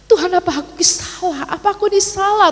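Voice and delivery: high, pleading question to God; tearful prayer. Retold or imitated. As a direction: retell a tearful plea, pitched high. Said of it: "Saya menangis". (ratapan doa bernada tinggi)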